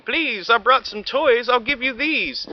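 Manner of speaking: high, slow pleading. Acted. Lonely dinosaur offering toys, high slow plea. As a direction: beg them gently to play. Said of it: "The Minosaur tried saying".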